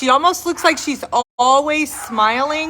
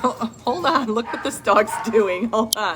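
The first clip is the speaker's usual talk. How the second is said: laughing while talking; 2 semitones lower, 3 dB quieter than usual. Real, not acted. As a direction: chuckle at the dog. Laughing at the dog.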